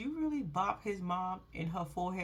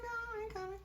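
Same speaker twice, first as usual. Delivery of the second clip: quiet lazy brush-off; 11 semitones higher, 9 dB quieter, much faster than usual. Retold or imitated. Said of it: "He was like".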